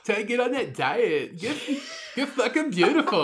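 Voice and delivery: put-on valley girl gushing through a laugh; sorority girl. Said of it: "There's a good sorority girl impression there".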